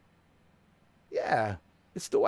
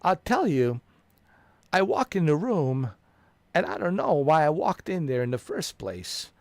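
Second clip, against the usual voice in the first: higher and louder, goofy storytelling; silly comic voice; 5 semitones higher, 7 dB louder than usual. Pretend. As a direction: play it dumb and loud for laughs. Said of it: "I'll say something so stupid like".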